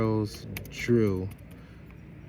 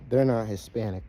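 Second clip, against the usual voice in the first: ordinary talk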